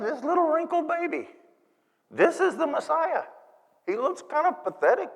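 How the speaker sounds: high-pitched, baffled disbelief; puzzled, let-down shepherd; incredulous doubt. Acted. Shepherd doubting the baby is Messiah.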